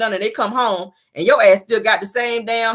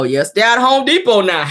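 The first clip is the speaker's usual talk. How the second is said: laughing. Real, not acted. Laughing at a chat joke.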